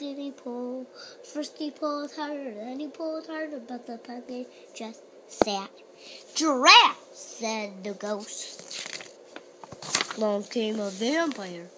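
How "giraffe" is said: loud straining grunt; effortful grunt. Acted. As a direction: heave like pulling hard. Ghost straining at the pumpkin.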